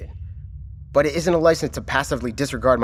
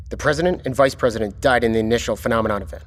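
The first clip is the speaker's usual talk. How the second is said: ordinary talk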